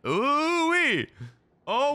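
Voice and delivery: high, loud delighted ooh-wee; excited whoop. Real, not acted. Reacting to the anime kiss, a high whoop. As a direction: let it fly up like a cheer.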